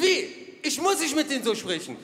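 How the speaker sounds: a hint of a father losing it; father flaring up. Father flaring up at his son in the car, mock outrage. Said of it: "Der so".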